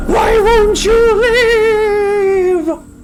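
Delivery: slow, drawn-out voice from the heavens; booming sky voice. Acted. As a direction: boom it down like a god in the sky. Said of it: "giant booming voice in the sky".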